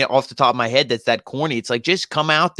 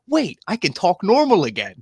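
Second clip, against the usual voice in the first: higher-pitched pretend revelation; mock sudden realization; 6 semitones higher than usual. Co-host imagines wrestler cured by head knock, mock realization. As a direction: play a dazed man suddenly discovering his voice. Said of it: "he's like".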